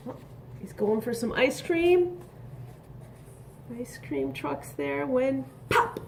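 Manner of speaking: ordinary talk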